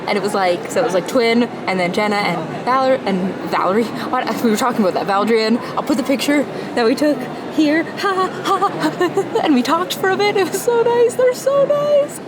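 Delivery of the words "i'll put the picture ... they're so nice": high-pitched, squealing with delight; giddy squeal. Real, not acted. Squealing to the camera after meeting her idols. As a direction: gush like a starstruck fan, way up high.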